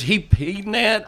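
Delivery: high, slow disbelieving question; wife can't believe it; wife's shocked question. Retold or imitated. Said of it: "She goes".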